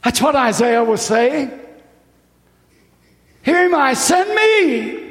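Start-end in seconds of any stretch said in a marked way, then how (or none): 3.4-5.0 high, ringing cry of a volunteer; eager cry. Acted. Preacher voicing Isaiah answering God, high and eager.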